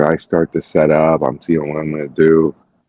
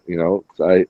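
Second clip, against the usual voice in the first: usual speaking voice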